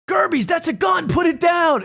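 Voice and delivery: high, loud shout; shouted action line. Retold or imitated. Show character yells at Gerbies, high loud shout. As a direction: yell it like a cop in a shootout. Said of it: "and then they go".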